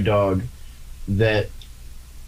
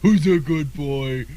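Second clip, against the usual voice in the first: high, slow cooing at a puppy; dog baby talk; 5 semitones higher, slower than usual. Acted. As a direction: coo it like praising a good dog.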